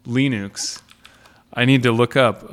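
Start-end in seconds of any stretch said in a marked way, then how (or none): 0.0-0.8 "Linux" said LEE-nooks; long ee and oo sound in "Linux"; pedantic Linux. Shown as a demonstration. Host declining the most pedantic form, LEE-nooks for "Linux". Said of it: "not so tremendously pedantic that I would say".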